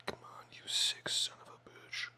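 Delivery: whispered strained curse. Real, not acted. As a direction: hiss it while cutting. Straining on last cut.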